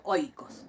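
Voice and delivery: oikos with oi run together in one syllable; todo junto. Shown as a demonstration. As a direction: say oikos low, O gliding straight into i. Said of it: "yo pronuncio esto todo junto". (oikos, diptongo todo junto)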